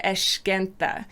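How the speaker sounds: "esquenta" with a sh in place of s; Rio sh pronunciation. Shown as a demonstration. Teacher shows what is heard in Rio, "esquenta" with sh. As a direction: say "esquenta" as "eshquenta", like a Carioca. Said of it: "specifically in Rio, generally you're gonna hear".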